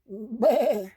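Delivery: high, wavering baa; high quavering sheep call; sheep bleat. A narrator's reading. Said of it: "say the sheep".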